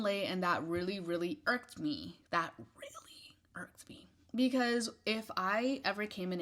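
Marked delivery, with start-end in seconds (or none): 2.6-4.1 slow, drawn-out whisper; hushed, deliberate, irritated whisper; emphatic whisper. Real, not acted. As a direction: whisper it slowly, stretching each word.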